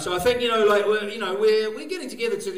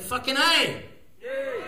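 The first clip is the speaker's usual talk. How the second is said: raised, high celebratory exclamation; triumphant cheer; 8 semitones higher, slower than usual. Pretend. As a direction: cry it out in triumph, A pitched up high. Celebrating that we made it.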